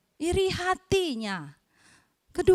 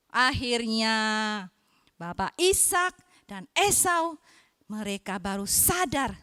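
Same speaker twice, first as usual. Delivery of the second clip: ordinary delivery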